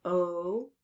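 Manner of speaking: "O" held alone; lone sustained "O"; isolated vowel sound. Shown as a demonstration. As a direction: draw out "O" as a bare vowel. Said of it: "We hear O".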